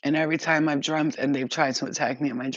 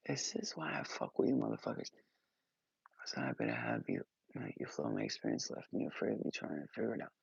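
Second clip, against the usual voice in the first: soft unvoiced whisper; 13 dB quieter than usual. Real, not acted. Speaker whispers thanks to her viewers, hushed. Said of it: "whispered aside".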